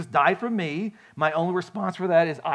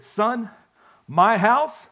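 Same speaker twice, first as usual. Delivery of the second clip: stern dad; 5 semitones higher, 7 dB louder, much slower than usual. Retold or imitated. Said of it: "And my dad said this".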